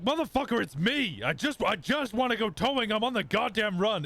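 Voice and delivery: high, heated retold outburst; exasperated retort. Retold heated phone argument.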